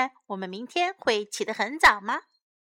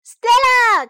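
high, very loud drawn-out call; excited cry; 11 semitones higher, 12 dB louder than usual. A narrator's reading. Sam calls Stella about Fred, excited cry. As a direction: call out at the top of the voice. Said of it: "cried Sam".

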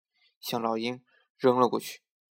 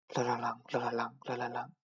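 fading cart bells; 9 dB quieter than usual. A narrator's reading.

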